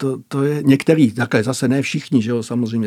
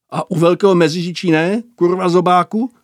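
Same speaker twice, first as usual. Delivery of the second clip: loud mocking jeer; 4 semitones higher, 6 dB louder than usual. A narrator's reading. Bogus mocking the recruit.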